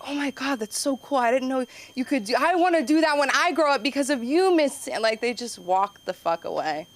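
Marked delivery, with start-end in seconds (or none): 0.1-5.0 put-on high-pitched gushing child; imitating an adoring little pupil; starstruck kid. Imagined admiring kid's reaction.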